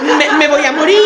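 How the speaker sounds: mock-tragic line dissolving into laughter; laughing. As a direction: laugh at her own old fear. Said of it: "fatídica".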